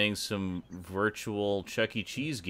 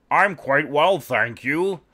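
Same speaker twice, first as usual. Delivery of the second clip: ordinary talk, nothing marked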